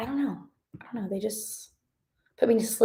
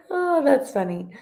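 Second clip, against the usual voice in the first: real high-pitched laugh running into the words; laugh, then speak through it, pitched up; amused laughing; 10 semitones higher than usual. Real, not acted. Streamer reacting to a viewer's joke about the title, laughing.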